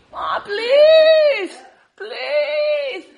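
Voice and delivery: loud high crying plea; begging child. Retold or imitated. Boy crying to his mom through the door, loud high wail. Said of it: "There's me".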